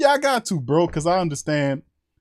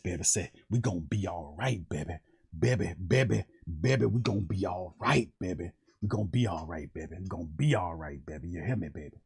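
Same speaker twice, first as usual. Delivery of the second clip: Reverend Saint family; 5 semitones lower than usual. Acted. Low reverend voice comforting the family about a story. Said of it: "that's Reverend Saint family hollering".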